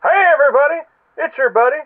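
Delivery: usual speaking voice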